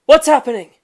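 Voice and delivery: high, loud, alarmed; startled guard asking; alarmed question. Acted. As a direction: burst in asking what's wrong. Young man rushes in.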